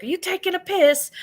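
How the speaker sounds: put-on high, bright British accent tease; mock British accent. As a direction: toss it off like a cheeky Brit, British accent. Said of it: "they're so awesomely British".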